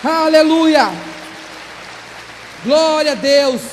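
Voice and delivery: real shouted hallelujah fervour; triumphant praise shout. Real, not acted. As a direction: proclaim deliverance at full voice to the congregation. Climax of testimony, praising God.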